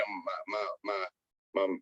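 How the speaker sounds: stammered, can't get started; stuttering. Retold or imitated. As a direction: stumble over the first word, re-enacting lost words. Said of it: "I'm, I'm stuttering".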